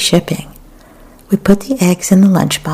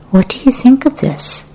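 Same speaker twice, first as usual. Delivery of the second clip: higher-pitched challenging question; 8 semitones higher than usual. A narrator's reading. Son challenges parent over his portrait, challenging. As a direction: put it as a teenager's challenge, pitched up. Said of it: "My son challenged me".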